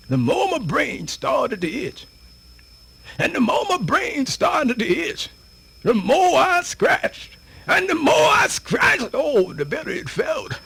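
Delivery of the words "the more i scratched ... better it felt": building up, louder and higher to a shout; climbing to an excited shout; rising excitement. Acted. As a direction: let it swell until it bursts out. Building excitement over scratching itch.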